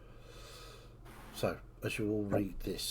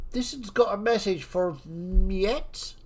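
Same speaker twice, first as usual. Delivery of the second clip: a bouncer announcing, higher, louder and slow; gruff bouncer; 11 semitones higher, 10 dB louder, slower than usual. Acted. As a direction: call it out like a pub bouncer delivering a kid. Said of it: "walks over and says".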